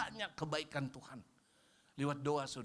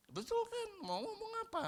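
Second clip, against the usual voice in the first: much higher, pointed questions; high rhetorical challenge. Acted. Pastor teasing the congregation, high and pointed. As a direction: challenge them playfully.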